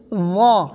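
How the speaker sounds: وا as a buzzed th with rounded aw; loud, thick وا; tongue out, heavy. Shown as a demonstration. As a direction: push the tongue out for وا, then fill it. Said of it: "زبان نکال کر".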